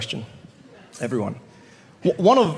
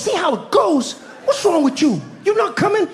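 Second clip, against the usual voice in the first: incredulous Harriet; 13 semitones higher than usual. Acted.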